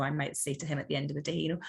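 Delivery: usual speaking voice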